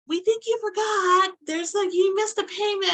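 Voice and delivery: mock notification voice; prim email voice. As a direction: read the email in a high, prim voice. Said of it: "then I get this email".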